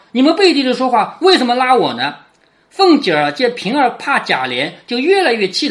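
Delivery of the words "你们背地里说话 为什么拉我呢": ordinary talk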